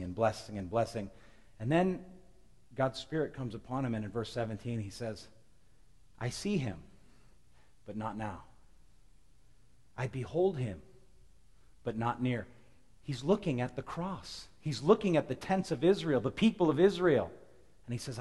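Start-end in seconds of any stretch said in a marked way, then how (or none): 6.2-12.5 solemn prophecy reading. Acted. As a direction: let each phrase hang.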